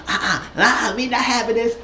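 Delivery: high-pitched indignant refusal; mock protest. Mimicking Toya not letting Simone talk, high mock protest. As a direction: shut it down in a squeaky mimicking voice.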